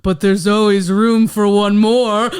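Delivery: slow, loud spooky host voice; ghost host voice. Retold or imitated. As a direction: intone it like a haunted host. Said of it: "the ghost host says".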